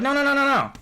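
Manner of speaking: high, quick repeated reassurance; seller's denial. Retold or imitated. Seller woman assuring the car is fine, high repeated no. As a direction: brush off the question breezily. Seller denying any problems.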